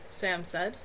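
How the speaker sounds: ordinary delivery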